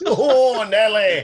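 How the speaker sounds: Oh, Nelly laughed out loud and high; laughing catchphrase. Retold or imitated. Co-host echoing the Regal catchphrase, laughing loudly. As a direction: giggle the catchphrase through laughter.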